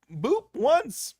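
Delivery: ordinary talk, nothing marked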